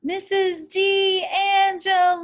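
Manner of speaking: loud, high and slow, stretched over the water; long drawn-out call. A narrator's reading. Boy calling the missing woman again, long and loud. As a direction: hold the name out so it carries far.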